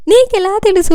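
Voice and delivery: étonnée, voix montée et plus forte; surprised. A narrator's reading. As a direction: une question soudaine, étonnée. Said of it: "అని ఆశ్చర్యంగా చూశాను".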